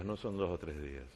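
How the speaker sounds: a quiet aside to the crowd; soft echo. Shown as a demonstration. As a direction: let it drop to a murmur.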